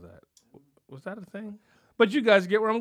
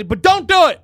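yelled, much higher and louder; comic shout; 8 semitones higher, 13 dB louder than usual. Acted. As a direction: scream the warning like a mock tantrum. Comic rant, shouting warning.

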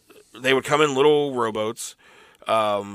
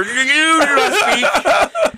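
high, slow, laughing command; haughty high voice; 12 semitones higher, 5 dB louder, much slower than usual. Acted. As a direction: lord it over the manager. Acting as egg company owner.

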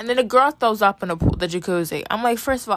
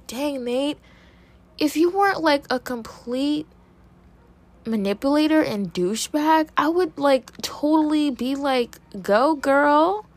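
high, drawn-out teasing cheer; sassy mock encouragement; mock hype; 6 semitones higher than usual. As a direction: gush at him as if half impressed. Mock cheering Nate in argument.

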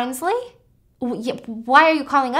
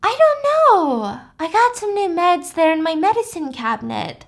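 higher, hazy voice; 6 semitones higher, 3 dB louder than usual. Retold or imitated. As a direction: drift through it, medicated. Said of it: "she's like".

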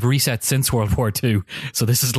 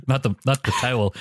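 usual speaking voice

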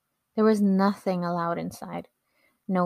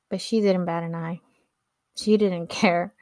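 plain reading voice

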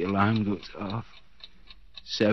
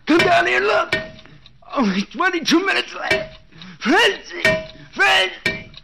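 high, loud calls for help; frantic cries for his wife; desperate shouting; 8 dB louder than usual. Acted. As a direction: yell up the stairs in panic. Trapped man calling out upstairs.

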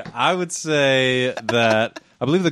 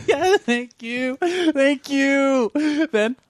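laughing; 13 semitones higher, much slower than usual. Real, not acted. Delighted by the invitation.

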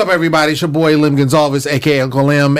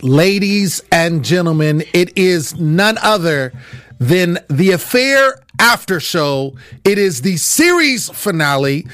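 high, emphatic announcer intro; show announcer; 7 semitones higher than usual. Acted. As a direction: hype up the series finale recap.

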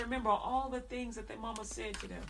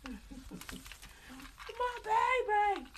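put-on much higher, wailing "my baby", slowed; crying mama; 11 semitones higher, slower than usual. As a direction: wail over your hurt child. Mother crying over child.

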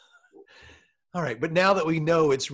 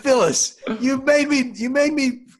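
high, louder exasperated husband; frustrated outburst; 8 semitones higher, 4 dB louder than usual. Acted. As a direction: blame her, rising frustration.

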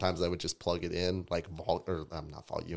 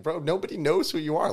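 exasperated telling-off; 11 semitones higher, 7 dB louder than usual. Retold or imitated. Retells telling off pushy artist.

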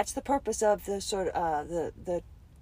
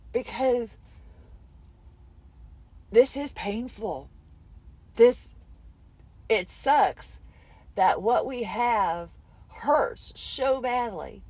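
holding back tears; 3 semitones higher, slower than usual. Real, not acted. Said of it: "And now I'm trying not to cry".

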